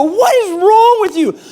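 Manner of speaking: high, loud mock outrage; acted indignant shriek; startled yell back. Startled couple's shouting, retold.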